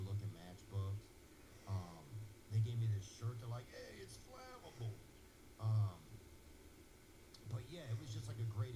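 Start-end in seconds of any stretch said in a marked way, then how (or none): 3.7-4.9 joking firefighter. Retold or imitated. Firefighters joking about shirt.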